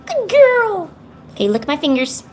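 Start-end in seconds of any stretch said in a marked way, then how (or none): 0.1-0.9 high, loud praise for a pet; cooing pet praise. Real, not acted.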